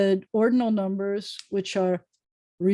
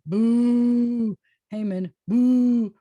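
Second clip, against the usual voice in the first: a louder, drawn-out boo, lightly hammed; mock boo; 2 semitones higher, 4 dB louder, much slower than usual. Teacher leads class booing Haman, playfully jeering. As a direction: jeer at the villain, playfully.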